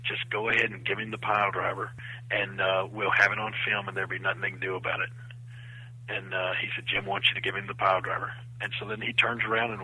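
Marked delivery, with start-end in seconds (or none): 0.0-5.1 low, hushed conspiratorial instruction; under the breath. Retold or imitated. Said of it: "kinda under his breath". 6.9-8.3 low, hushed conspiratorial instruction; under the breath. Retold or imitated. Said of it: "kinda under his breath".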